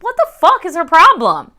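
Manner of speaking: high, exasperated rhetorical question; mad. Retold or imitated. Reader complaining about the book's heroine, mock-mad. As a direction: act out being fed up with her. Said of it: "I was so mad".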